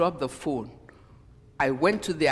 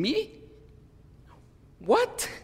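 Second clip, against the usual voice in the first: much higher, incredulous "Me? What?"; shocked disbelief; 11 semitones higher than usual. Retold or imitated. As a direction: pitch jumps up in disbelief. Hears friend complain about her.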